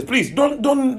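usual speaking voice

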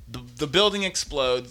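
ordinary delivery

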